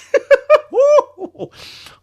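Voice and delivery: high, loud incredulous laugh; loud burst of laughter. Real, not acted. Streamer bursts out laughing at the statement. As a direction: crack up at the absurd line.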